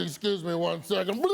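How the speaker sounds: ordinary delivery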